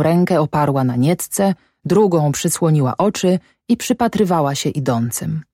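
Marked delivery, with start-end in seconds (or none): none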